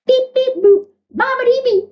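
high-pitched comic spellcaster; Doctor Fate. Acted. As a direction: chant a silly beeping spell in a high voice. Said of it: "Doctor Fate appears".

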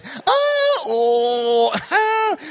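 loud, high ah falling into oh; loud empty ah oh. Retold or imitated. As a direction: bluff with noise. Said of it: "making all those noises that mean absolutely nothing".

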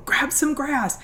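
put-on high, bossy little-girl instruction; little daughter instructing. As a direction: instruct like a confident nine-year-old. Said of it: "my little nine-year-old going".

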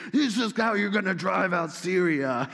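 hammed-up boast of a false prophet; mock cheerleading. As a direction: play the hyped-up yes-man. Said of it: "your cheerleading squad over here".

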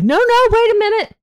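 high, loud mock interruption; urgent retold cry. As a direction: imitate a pilot calling out to stop them.

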